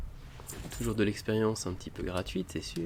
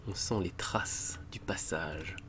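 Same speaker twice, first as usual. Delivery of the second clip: slow secretive whisper; 4 semitones lower, 6 dB quieter, slower than usual. Acted. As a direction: breathe it out slowly. Sneaking after the hiding monsters.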